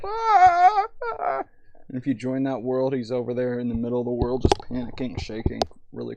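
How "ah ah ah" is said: mock panicked wails. Imitating panicked user crying.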